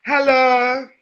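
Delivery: high, bright, sing-song hello; exaggerated greeting. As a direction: greet like a cheery tourist. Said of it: "Everyone have to be all like".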